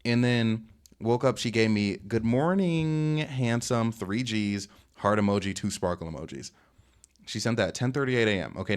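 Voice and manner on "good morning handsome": drawn-out, high "good morning", imitating her text; flirty greeting. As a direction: stretch out a sweet, flirty wake-up greeting.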